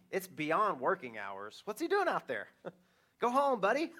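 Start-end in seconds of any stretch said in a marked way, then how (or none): 3.2-3.9 high, louder playful jab; teasing. Real, not acted. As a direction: tease him like a friend. Joking aside to older son.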